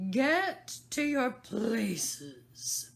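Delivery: very slow, loud roar; the Queen thunders at the croquet players. A narrator's reading. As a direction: thunder the command. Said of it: "in a voice of thunder".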